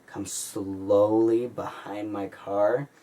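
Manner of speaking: slow, stretched suspense on slowly; slowly drawn out. Retold or imitated. Driver tells of lights creeping behind the car, drawn out.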